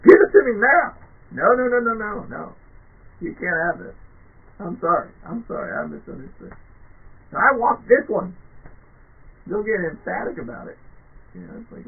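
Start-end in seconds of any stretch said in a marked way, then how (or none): 0.0-0.9 put-on loud, whiny demand like a spoiled kid; hammed-up whining customer; mock tantrum. 1.3-8.4 mock backpedaling customer.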